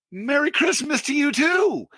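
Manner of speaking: high, sing-out sarcastic holiday wish; retold sarcastic yell into the phone; mock cheery retort. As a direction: call it back high-pitched, with fake cheer. Sarcastic retort after being screamed at.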